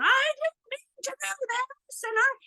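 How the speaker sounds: put-on high, loud protesting child; high loud defensive child. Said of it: "defending herself".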